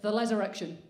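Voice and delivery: ordinary talk, nothing marked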